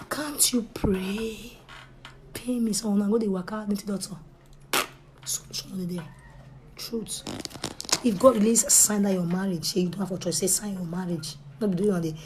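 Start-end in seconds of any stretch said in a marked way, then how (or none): 0.1-1.6 breathy hushed whisper; slow whispered question; whispered aside. Real, not acted. 5.2-7.2 whisper. Real, not acted. Whispered aside.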